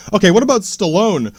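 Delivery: ordinary talk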